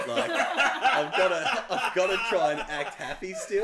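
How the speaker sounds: high, loud, drawn-out through laughter; laughing exclamation. Retold or imitated. Husband retelling his worry that wife botched it, laughing. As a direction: stretch the retold thought out, cracking up.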